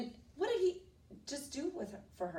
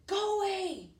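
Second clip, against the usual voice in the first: high, drawn-out storybook shout; dragon's shout; 13 semitones higher, 5 dB louder, slower than usual. Acted. Dragon to princess at the door, shouting. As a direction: call it out to send her off.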